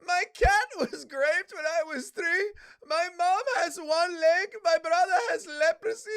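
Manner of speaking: high, loud mock crying sob story; hammed-up weepy sob story; sob-story bit. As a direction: wail it like a fake tragic backstory. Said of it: "You're doing a bit".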